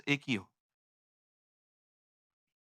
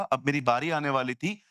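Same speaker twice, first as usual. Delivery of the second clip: ordinary talk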